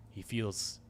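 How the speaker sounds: plain reading voice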